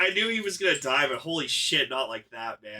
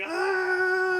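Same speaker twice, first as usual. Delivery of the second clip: startled gasp; 4 dB louder than usual. Retold or imitated. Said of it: "He's just like".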